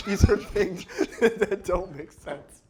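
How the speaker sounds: talking through real laughter; laughing. Real, not acted. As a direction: laugh between the words, slowing down. Cracking up at the absurd remedy.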